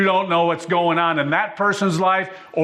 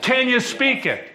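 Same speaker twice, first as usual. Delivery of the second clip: higher questions rising in intensity; building fervour; 5 semitones higher than usual. Acted. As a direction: stir the congregation question by question.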